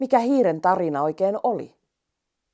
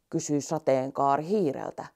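ordinary delivery

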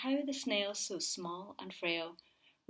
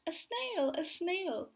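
high startled shriek; 9 semitones higher, slower than usual. Acted. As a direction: shriek like a teacher who saw a snail. Teacher shrieks at snail.

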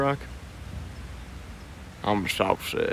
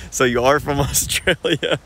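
laughing retold quote; 4 semitones higher, 3 dB louder than usual. Retelling a girl's amused reply.

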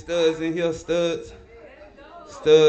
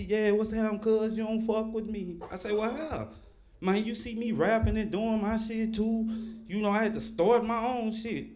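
lightly raised voice for the cousin; imitating his cousin; 6 semitones higher than usual. Telling about his Mississippi cousin, a light higher cousin voice. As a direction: play the cousin a little higher, casual.

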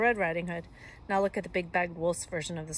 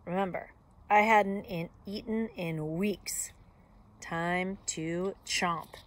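ordinary delivery

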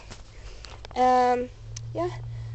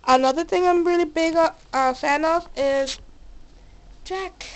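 ordinary talk

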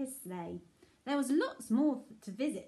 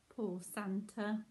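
ordinary delivery, nothing marked

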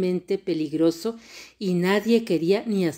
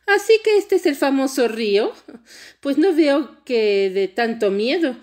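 unimpressed; 8 semitones higher, 5 dB louder than usual. A narrator's reading. Princess scoffing at the famous river, unimpressed. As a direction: scoff in a raised girlish princess voice. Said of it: "Notombi estaba decepcionada".

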